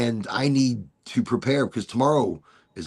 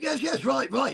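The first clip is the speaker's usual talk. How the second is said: flustered dad; 11 semitones higher than usual. Retold or imitated. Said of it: "my dad's like".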